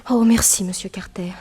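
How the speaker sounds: whispered, breathy thanks; thanking the detective in a whisper; grateful whisper. Acted. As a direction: whisper your thanks, deeply moved. Client thanks the detective, moved.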